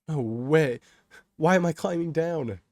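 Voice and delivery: loud, high incredulous protest; panicked disbelief. A narrator's reading. Kay finding himself climbing onto the tracks, panicked protest. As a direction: wail it in disbelief.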